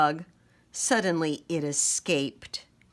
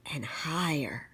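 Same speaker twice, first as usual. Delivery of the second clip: whispered; 3 semitones lower, 8 dB quieter than usual. A narrator's reading. Swing builds, narrator hushes.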